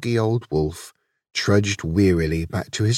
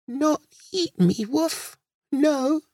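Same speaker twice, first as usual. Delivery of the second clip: put-on very high, small baby talk; little lamb's voice; slower than usual. A narrator's reading. Lamb making sure the wolf won't eat her, squeaky. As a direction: speak tiny and high, checking carefully.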